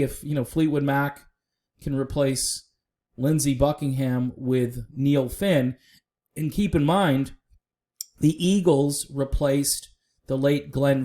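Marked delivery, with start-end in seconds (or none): none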